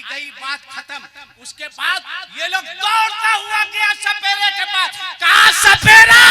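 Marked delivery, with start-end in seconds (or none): 1.4-6.2 high, loud fiery sermon; full-force preaching. Acted. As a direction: preach it at the top of your voice. (जोशीला ऊँचा प्रवचन)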